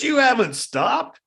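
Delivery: high, loud, slow retort; a bandit shouting him down; angry yell. Retold or imitated. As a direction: throw it back at him.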